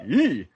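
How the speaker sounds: high squeal of recoil; quick, high-pitched noise of distaste; imitated recoiling yelp. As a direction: re-enact an involuntary "ee" at an ugly sight. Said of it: "I think I made a verbal".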